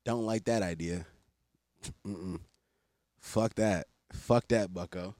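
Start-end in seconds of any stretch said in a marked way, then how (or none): none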